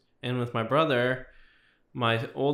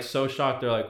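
ordinary talk

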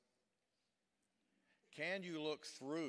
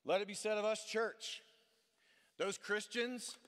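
climactic preaching cry; 7 semitones higher, 6 dB louder than usual. Real, not acted. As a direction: lift the whole church with it. Climactic appeal to the church.

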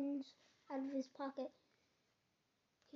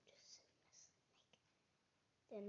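hissing whisper; 4 semitones lower, 18 dB quieter, much slower than usual. A narrator's reading. Snake voice in narration.

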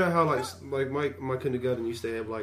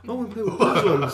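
put-on high, louder laughing kid whine; mock demanding kindergartner through laughs; whiny kid, laughing; 8 semitones higher, 8 dB louder than usual. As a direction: insist on the toy like a bratty child. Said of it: "and then this guy".

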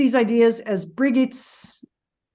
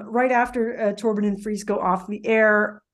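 ordinary talk

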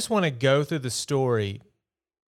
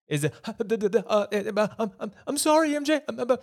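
higher, stammering nervous apology; mocking flustered Spider-Man; 10 semitones higher than usual. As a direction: parody a jittery teen hero fumbling sorry. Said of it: "how many times did he apologize".

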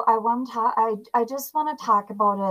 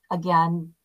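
ordinary talk

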